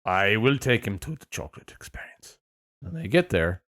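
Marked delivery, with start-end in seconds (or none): 0.1-2.4 lower, measured mock-British line; deliberate put-on British accent; really British accent. Shown as a demonstration. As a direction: lean into a British accent, pitched down. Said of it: "that was really British".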